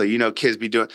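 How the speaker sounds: normal talking voice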